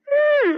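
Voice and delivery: a sharp, loud, high hmm of grief; loud high grief grunt. Acted.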